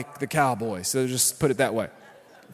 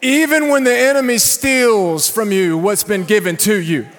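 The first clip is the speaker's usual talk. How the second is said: high, loud shouted sermon climb; full-force preaching; 9 semitones higher, 9 dB louder than usual. Real, not acted. Pastor to congregation, loud shouted preaching. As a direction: preach it hard to raise the room.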